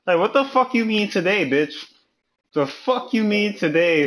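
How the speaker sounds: high-pitched, put-on outrage; mock indignation. Acting out a comeback to a compliment, mock outraged. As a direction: throw it back as an offended comeback.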